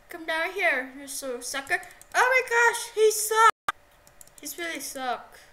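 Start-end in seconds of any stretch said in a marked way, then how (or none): 2.1-3.5 loud mocking. Real, not acted. Mocking other player.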